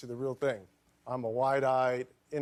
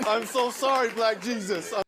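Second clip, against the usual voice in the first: mock apology; 9 semitones higher, 6 dB louder than usual. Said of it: "I'm like".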